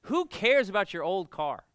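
loud, high mock rant; flipped out. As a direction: snap back at dad like an angry son. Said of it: "I flipped out".

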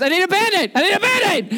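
hurt son begging dad for a Band-Aid, high wail; wailing child. Retold or imitated. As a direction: whine it like a kid clutching his ankle. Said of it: "he's just screaming".

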